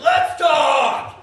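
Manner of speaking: high, loud, eager yell; eager shout. Pretend. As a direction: shout it, thrilled to be asked. Imagined eager reply.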